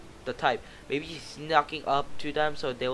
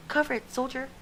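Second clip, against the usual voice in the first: high, far officer voice; barking soldier; 11 semitones higher than usual. Acted. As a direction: order it sharply. Soldier gives order.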